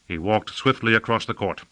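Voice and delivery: plain reading voice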